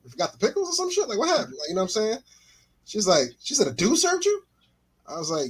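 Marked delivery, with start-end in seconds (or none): 3.7-4.4 put-on girlfriend, pitched way up in disbelief; a hint of a stunned girlfriend; mock girlfriend. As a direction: play the shocked girlfriend, voice jumping up. Girlfriend voiced in story.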